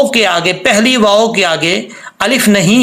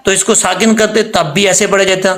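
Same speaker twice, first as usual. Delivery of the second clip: normal talking voice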